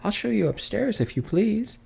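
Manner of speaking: high, polite maid's voice; a young housemaid showing the way; servant girl voice. Acted. Maid politely answers Scrooge in a high voice.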